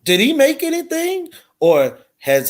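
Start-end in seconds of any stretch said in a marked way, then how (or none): 0.0-1.3 incredulous. Real, not acted. Mocking the troll's output.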